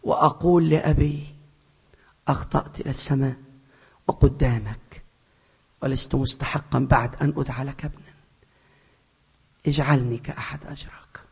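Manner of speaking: head bowed, hushed and broken; a whispered plea for forgiveness; humble whisper. Acted. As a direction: whisper the confession in shame. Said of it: "راسي تبقى لتحت".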